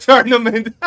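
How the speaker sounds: word squeezed out through loud laughter; laughing. Real, not acted. Streamer cracking up about the cheater losing, laughing loudly.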